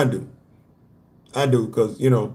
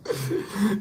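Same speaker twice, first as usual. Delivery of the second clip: soft, high laughing fit; 5 semitones higher, 8 dB quieter than usual. Real, not acted. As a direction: crack up quietly. Laughs after the quote bit.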